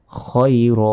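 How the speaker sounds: "খৈর" with heavy throaty kho, not kha; সঠিক উচ্চারণ. Shown as a demonstration. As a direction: round the first vowel of "খৈর" after a back-of-throat kh. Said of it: "খ জবর হয় খ". ("খৈর" মোটা খ দিয়ে, খা নয়)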